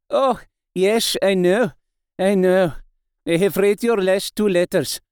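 put-on foreign-accented professor cutting in; accented old doctor, interrupting. Doctor interrupts Mina about her letters, accented voice.

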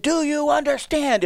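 high-pitched nervous rookie coach. Retold or imitated. Young coach explaining a drill to giggling players, nervous. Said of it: "I'm a nervous wreck".

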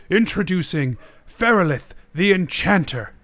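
measured court herald, each name set apart; proclaiming, deliberate and ceremonial; herald's announcement. Acted. Guard announces visitor to the court.

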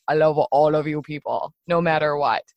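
relaxed buddy voice of God, lightly done; cool easygoing God. Pretend. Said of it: "a really, really cool guy that is like".

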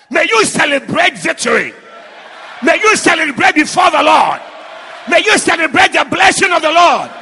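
rising loud call to celebrate; preaching at full force. Acted. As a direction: whip the congregation up.

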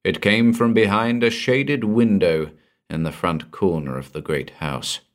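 ordinary delivery, nothing marked

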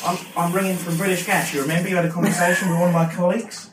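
high, quick Australian accent; bad Australian accent. Shown as a demonstration. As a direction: fake Aussie caller voice. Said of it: "a really bad Australian accent".

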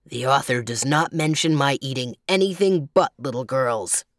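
lightly growled grizzly reply; growly bear. A narrator's reading. Bear explaining what the author lets him eat, growly. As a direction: answer as a deep-voiced bear, deliberately. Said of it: "replied the bear".

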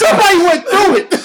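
loud, high, cracking-up exclamation; high, loud hilarity; laughing hard. Real, not acted. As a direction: shout it through real laughter. Cracking up at the thought.

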